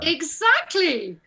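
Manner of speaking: high, drawn-out vindicated cry; slow, rising-pitched wholehearted agreement; emphatic agreement. Real, not acted. Actor agreeing the costumier overstepped, emphatic.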